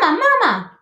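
put-on high, loud baby-bird call; tiny gosling voice; peeping gosling. A narrator's reading. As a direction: peep for mother like a hatchling. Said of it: "peeped the gosling".

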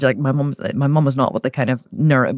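normal talking voice

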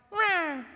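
tiny, high-pitched squeak of a roar; puny roar. Acted. Believers on their own power, puny little roar. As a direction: squeal a feeble cub roar. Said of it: "you know what we sound like".

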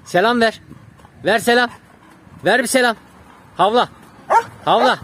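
loud, high, short orders to a dog; dog commands. Real, not acted. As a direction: order the dog to perform its tricks. Giving trained dog commands.

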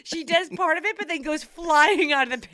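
very high, loud, laughing voice; helpless laughter. Real, not acted. Telling how her sister failed too, cracking up. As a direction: struggle to get the story out through laughter.

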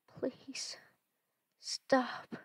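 high, breathless whispered plea; pleading whisper. Acted. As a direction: beg in a frightened whisper. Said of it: "my mother cried out".

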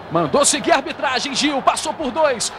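normal talking voice

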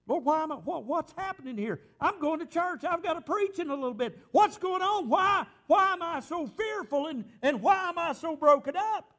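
crying out to God high and loud in fear; panicked prayer. Retold or imitated. A frightened pastor cries out to the Lord, loud desperate plea. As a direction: plead with the Lord, scared and breaking.

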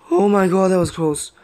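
loud, high gasped exclamation; shocked. Real, not acted. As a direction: burst out after a near miss. Near miss in the game.

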